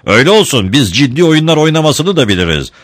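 shouting. A narrator's reading. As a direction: moderate shout at a rival gang. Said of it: "diye bağırdı sonra".

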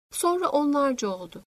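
ordinary delivery

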